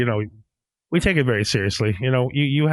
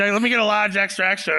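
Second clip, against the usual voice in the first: Boston order voice; 8 semitones higher than usual. Retold or imitated. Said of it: "I'm like".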